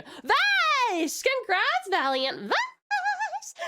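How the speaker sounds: high, celebratory cheer; excited congratulations. Real, not acted. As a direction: cheer the winner's name, drawing it out high. Announces the giveaway winner.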